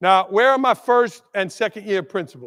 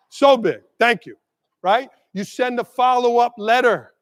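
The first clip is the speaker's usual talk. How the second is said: normal talking voice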